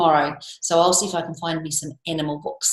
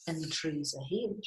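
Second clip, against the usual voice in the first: wary listening; 10 dB quieter than usual. Acted. Gruffalo listening in the wood.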